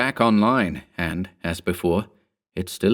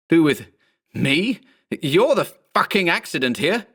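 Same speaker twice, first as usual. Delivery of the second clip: higher, indignant retort; outraged retort; 9 semitones higher than usual. A narrator's reading. Mark throws the clone's words back, outraged.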